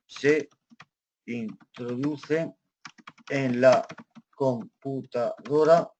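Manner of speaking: very slow, broken into syllables; halting typing pace with gaps between syllables; slow dictation. Real, not acted. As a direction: spell it out chunk by chunk as you type. Dictates aloud while typing.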